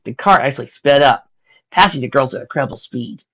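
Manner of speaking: usual speaking voice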